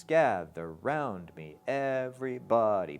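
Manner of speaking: GATHER stretched, beats marked; trochaic stress. Shown as a demonstration. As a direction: spell out the trochees slowly. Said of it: "That feels like a trochee".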